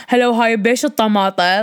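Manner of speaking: imitating mom's harsh Iraqi dialect; hard-hitting Iraqi Arabic at the grocery store. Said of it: "the Iraqi one is so harsh".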